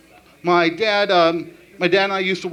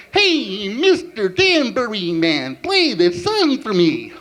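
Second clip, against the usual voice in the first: slow, higher goofy moose voice; Bullwinkle the Moose; 4 semitones higher, much slower than usual. Acted. Karaoke song in a slow goofy moose voice. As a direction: drawl it like a dopey cartoon moose. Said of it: "the voice of Bullwinkle the Moose".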